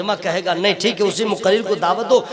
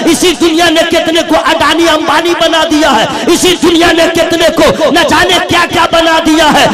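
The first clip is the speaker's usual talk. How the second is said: raised, ringing sermon voice building over each line; full-force preaching; 8 semitones higher, 11 dB louder than usual. Real, not acted. Preacher rousing the congregation, full-force preaching. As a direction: preach it out to the crowd.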